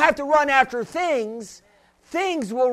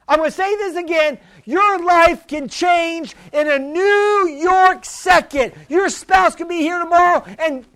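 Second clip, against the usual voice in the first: raised, driving preacher's cry, slowing on key lines; preaching at full force; 4 semitones higher, 4 dB louder than usual. Acted. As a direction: hammer home that God's grace holds. Preacher building to climax.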